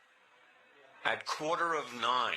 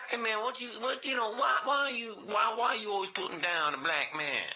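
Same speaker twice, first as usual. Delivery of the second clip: higher, pushy mock complaint; 9 semitones higher than usual. Fake caller Dwayne rehearsing an indignant complaint, higher. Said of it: "I was gonna do that thing".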